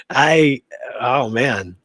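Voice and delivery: ordinary delivery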